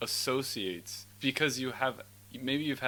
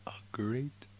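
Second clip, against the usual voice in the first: whisper; 3 dB quieter, much slower than usual. Pretend. Slow whisper.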